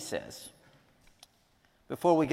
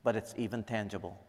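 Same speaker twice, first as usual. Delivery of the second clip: soft, reverent prayer voice; 3 semitones lower, 3 dB quieter than usual. Acted. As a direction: pray quietly to God for the room. Opening prayer before sermon.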